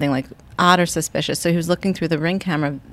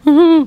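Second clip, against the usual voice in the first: high, loud pretend wail; mock bawling; 11 semitones higher, 7 dB louder than usual. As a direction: imitate yourself bawling at a sad song.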